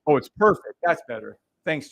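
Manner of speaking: ordinary talk, nothing marked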